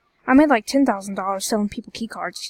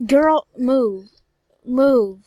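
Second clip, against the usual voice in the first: louder, snapped order to move; loud command; 2 semitones higher, 9 dB louder, much slower than usual. Real, not acted. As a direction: bark it at someone blocking you. Yelling at game character.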